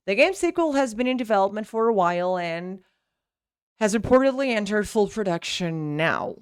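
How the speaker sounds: words linked in a quick unbroken flow; fast connected reading. Shown as a demonstration. Teacher modelling connected speech, fast linked reading. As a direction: read it briskly, no stops mid-sentence. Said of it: "I never really stop in the middle".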